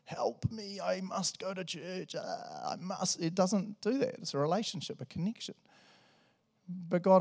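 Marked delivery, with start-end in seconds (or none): 0.0-3.2 higher, softer, stilted robot; mock robot pleading for help. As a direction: drone it out like a helpless, programmed robot. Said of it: "turn into this robot where you're just like".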